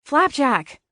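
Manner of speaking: loud, high rebuke of a name; sharp scolding call. Acted. Scarlet scolds Flapjack, loud and high. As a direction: snap his name to shut him up.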